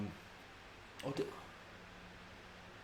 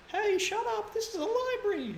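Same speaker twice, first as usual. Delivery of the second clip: high-pitched shushing voice. Retold or imitated. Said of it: "someone's like".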